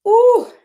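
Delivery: high loud ooh; stretching groan. Real, not acted. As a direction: let out a big stretch sound. Stretching, exhaling hard.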